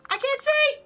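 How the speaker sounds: high, loud squeal of panic; mock panic. Playing the game, she shrieks she can't see, high and loud. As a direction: shriek like you're suddenly blind.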